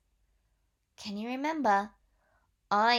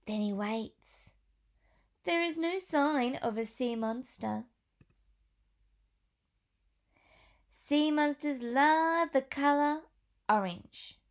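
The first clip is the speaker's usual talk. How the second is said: put-on reading to children; 2 semitones higher than usual. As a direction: clear storybook read-aloud voice. Reads picture book to children.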